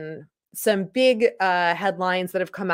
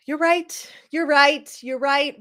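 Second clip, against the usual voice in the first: higher, louder you're right repeated; loud grudging agreement; 7 semitones higher, 3 dB louder than usual. Retold or imitated. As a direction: say it back louder each time, grudging. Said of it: "I'm like".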